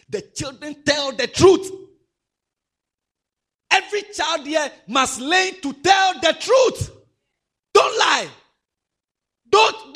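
loud, high, slow preacher's shout; shouted preaching. Acted. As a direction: thunder it at the children. Preaching at full force.

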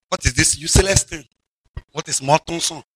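ordinary delivery

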